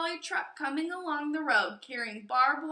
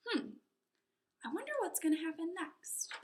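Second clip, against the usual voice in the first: soft, quick wondering aside; softer playful musing; curious aside; 4 semitones higher, 8 dB quieter, faster than usual. A narrator's reading. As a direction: tease the children about what's next. Narrator aside to listeners.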